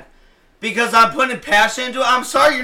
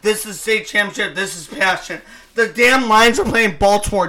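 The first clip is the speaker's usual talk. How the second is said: usual speaking voice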